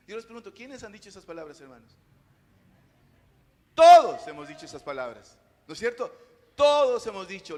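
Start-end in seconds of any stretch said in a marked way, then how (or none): 3.7-7.6 louder, lower, punching "Todos"; loud preaching. Acted. Pastor telling the congregation everyone has said it, loud preaching. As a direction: hit it hard like a sermon accusation.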